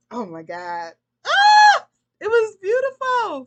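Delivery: very high, loud gasp of joy, then slow and rapturous; overjoyed, very high and loud, slowing down on the praise; ecstatic delight. Real, not acted. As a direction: gush like you just saw a masterpiece. Delight at the symphony of workers.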